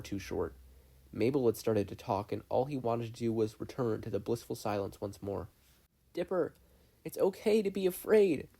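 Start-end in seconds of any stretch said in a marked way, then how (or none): 6.1-8.5 put-on gentle girl voice. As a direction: reassure him like a caring sister. Mabel comforts her brother.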